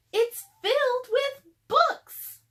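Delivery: high, loud, slow delighted shout; thrilled Annie. A narrator's reading. Annie calling down from the treehouse, high thrilled shout. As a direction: shout her joy down to him.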